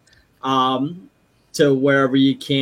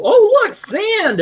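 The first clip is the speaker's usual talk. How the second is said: high, excited little cry; delighted squeal; 5 dB louder, much slower than usual. Retold or imitated. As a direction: squeal with joy at the sand. Scot at beach, voiced.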